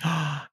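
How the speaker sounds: quick high intake of breath; sudden short gasp; gasp of recognition. Real, not acted. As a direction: gasp as a memory comes back. Suddenly remembers the concert.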